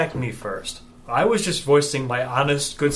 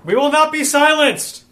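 shouting; 11 dB louder than usual. Acted. Protester shouting at rally.

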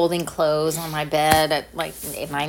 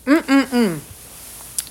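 slow, drawn-out yummy hum; savoring hum; 7 semitones higher, 5 dB louder, much slower than usual. Real, not acted. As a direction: hum like it's delicious. Tasting a protein bar.

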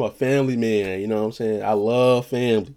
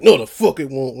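blunt retort; 3 semitones higher, 6 dB louder than usual. Retold or imitated. Mock retort to the wife's question.

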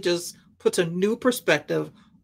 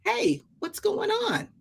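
high, slow, puzzled call to a servant; older son calling out; 13 semitones higher, 4 dB quieter, slower than usual. Retold or imitated. Older son calls to the servant, high and drawn out. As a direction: stretch it out like a confused son. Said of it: "calls one of his father's servants over and says".

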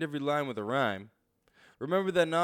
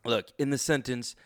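normal talking voice

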